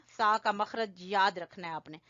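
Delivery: normal talking voice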